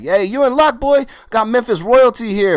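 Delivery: put-on high, loud proud old man. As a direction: announce your famous guests with swagger. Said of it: "He's like".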